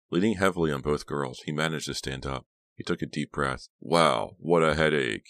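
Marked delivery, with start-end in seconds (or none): 3.8-5.3 loud exclamation of pain; mildly pained outburst; crying out. A narrator's reading. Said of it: "he cried".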